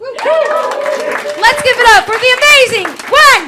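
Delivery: loud, high introduction building to the name; loud MC intro. Real, not acted. Comic introducing the next comedian to the crowd, a loud, high build. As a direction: bring up the next act with a big call.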